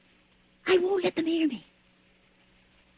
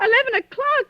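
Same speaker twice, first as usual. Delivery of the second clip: sharp rising disbelief, higher and louder; startled; 7 semitones higher, 3 dB louder than usual. Acted. Frightened wife on the phone, startled by the hour. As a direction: catch on the hour in alarm.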